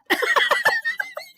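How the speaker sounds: sudden high laugh; high burst of laughter. Real, not acted. Laughing at his own made-up story.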